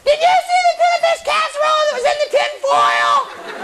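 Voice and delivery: high, screeching mom holler; hammed-up motherly shriek; mother's voice. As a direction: call out shrilly like an accusing mother. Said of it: "mother's glowing voice".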